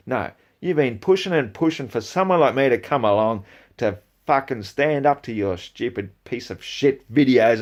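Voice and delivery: ordinary talk, nothing marked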